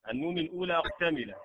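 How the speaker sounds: ordinary delivery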